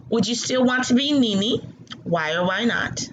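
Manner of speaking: ordinary delivery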